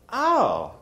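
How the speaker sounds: high pitched "oh" of realization; sudden high "Oh."; a realization caught mid-breath; surprised oh. Retold or imitated. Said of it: "And I was like".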